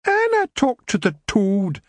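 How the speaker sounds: put-on slow, high Scots girl voice; arguing her case; pleading lassie.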